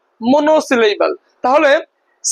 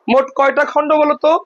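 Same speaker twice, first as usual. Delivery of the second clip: ordinary talk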